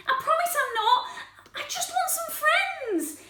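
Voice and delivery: high-pitched, wounded protest; hurt pleading. Acted. Spike just wants to be their friend, high hurt pleading. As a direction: plead to be given a chance.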